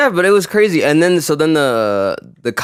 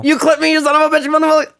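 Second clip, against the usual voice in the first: cussing him out; 13 semitones higher, much faster than usual. Retold or imitated. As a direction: rage at the driver, spitting the curse. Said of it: "Cussing him out".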